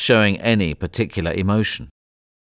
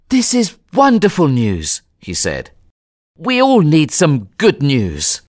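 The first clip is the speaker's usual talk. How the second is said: happy; 5 semitones higher than usual. Acted. Passepartout happy.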